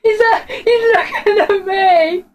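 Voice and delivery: high, slow frightened cry; freaked out, dragging the words; high panicked slow exclaim; scared slow exclaim. Real, not acted. Monster staring at player.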